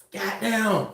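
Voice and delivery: ordinary delivery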